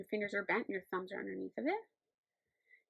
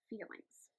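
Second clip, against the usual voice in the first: soft voiceless whisper of feelings; whispered word; 12 dB quieter than usual. Shown as a demonstration. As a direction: breathe the word Feelings without voice. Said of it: "slightly brush your chest".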